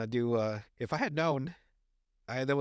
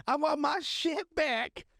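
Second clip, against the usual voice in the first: high, slow, drawn-out demand; whining tweaker; 12 semitones higher, much slower than usual. Retold or imitated. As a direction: imitate a crackhead wailing outside the door. Voices pacing tweaker demanding his things.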